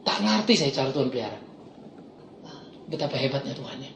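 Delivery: low, soft, reflective testimony; hushed devotional preaching. Acted. Preacher reflecting on pain drawing him to God, hushed reverence. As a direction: let it sink in quietly, awed.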